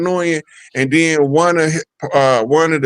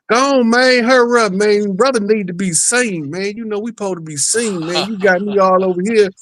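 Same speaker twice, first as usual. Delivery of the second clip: channeling [name]; 7 semitones higher than usual. Retold or imitated. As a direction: voice the spirit nagging him to hurry up. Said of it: "He keep telling me".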